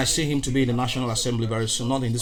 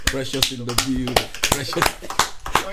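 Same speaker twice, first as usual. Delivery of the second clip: echo hype call; slower than usual. Acted. Echoing hype intro of guest.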